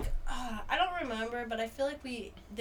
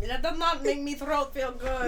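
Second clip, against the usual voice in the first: laughing; 4 semitones higher, 4 dB louder than usual. Real, not acted.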